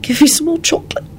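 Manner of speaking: high, feeble near-whisper; weak voice. A narrator's reading. Shaken Elizabeth asks Lupin for chocolate, weakly. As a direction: ask weakly, with little breath. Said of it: "she said weakly".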